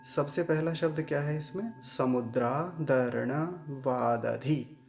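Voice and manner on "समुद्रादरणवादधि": each word twice, very slow and careful; slow word-by-word drill. Shown as a demonstration. Teacher drills every word twice for students. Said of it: "सभी शब्दों का पुनः उच्चारण देख लीजिए".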